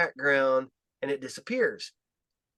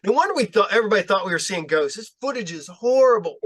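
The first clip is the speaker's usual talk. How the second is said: higher and louder, half-laughing disbelief; mock exasperated rant; 4 semitones higher, 6 dB louder than usual. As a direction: act out the disbelief at bad footage. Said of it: "and I'm just like".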